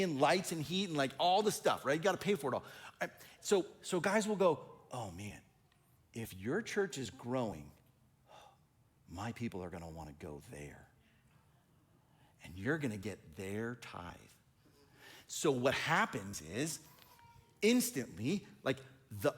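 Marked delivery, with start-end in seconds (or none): 4.9-14.0 a jealous pastor worried about losing members, low and muttering; jealous grumble. Retold or imitated. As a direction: grumble it low under your breath.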